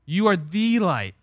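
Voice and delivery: "the" drawn out as an emphatic "thee"; slow, weighted line landing on "the"; stress on THE. Shown as a demonstration. Preacher contrasting it with a light, "the" stressed. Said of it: "not, not a light".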